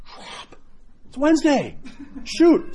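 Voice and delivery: sudden high yelp of dismay, half laughing; caught-out dismay. Retold or imitated. Friend catching himself with beef jerky, mock dismay. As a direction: realise mid-bite it's a fast day. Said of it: "and he goes".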